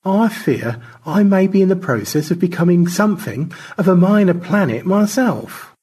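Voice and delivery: George, politely alarmed. Acted. Astronaut adrift confessing to his crewmate, politely alarmed. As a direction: raise the pitch in formal alarm.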